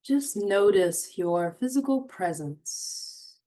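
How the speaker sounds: unhurried meditation voice with long pauses; guided meditation. Acted. Host guiding listeners to notice their surroundings, guided meditation. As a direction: guide them gently, phrase by phrase, leaving space.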